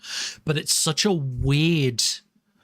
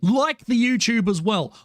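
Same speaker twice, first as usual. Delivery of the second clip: hammed-up plea; 8 semitones higher, 3 dB louder than usual. Asking chat to like.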